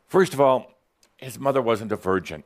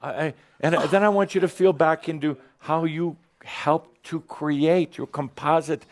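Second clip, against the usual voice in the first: guided meditation; 6 semitones higher than usual. Real, not acted. Guiding inner feeling exercise.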